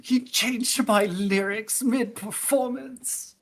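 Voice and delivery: high, slow sniffly complaint; weepy whine. Acted. Linebeck whining tearfully to Dee Dee after being mocked. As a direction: whimper it like a hurt crybaby. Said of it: "He's, like, sniffling and he's, like, wiping tears away".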